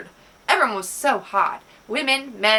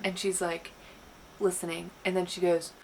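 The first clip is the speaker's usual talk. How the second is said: ordinary delivery, nothing marked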